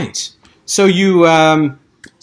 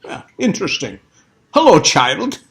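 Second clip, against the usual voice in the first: put-on condescending old man; 9 semitones higher, 4 dB louder than usual. GM voices Doctor Smith.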